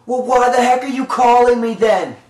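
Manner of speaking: ordinary talk, nothing marked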